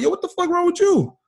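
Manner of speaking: hammed-up outrage at a friend who vanished; mock exasperated friend.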